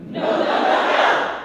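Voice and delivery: mock mad at the dog; scolding the dog. Shown as a demonstration. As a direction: shake your finger and bark no. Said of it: "here we go".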